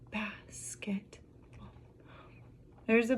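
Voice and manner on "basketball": slow whisper, syllable by syllable. Shown as a demonstration. Teacher repeating a multi-syllable word, whispered slowly. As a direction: whisper "Basketball" in separate beats.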